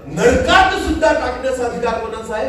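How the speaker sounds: high, emphatic preacher's proclamation; forceful preaching. Real, not acted. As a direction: drive the teaching home to the crowd.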